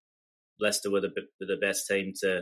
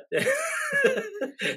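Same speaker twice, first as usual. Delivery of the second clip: sudden high-pitched laugh. Real, not acted. Laughs at own misery.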